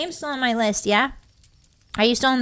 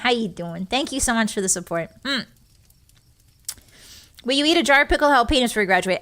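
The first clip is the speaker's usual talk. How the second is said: usual speaking voice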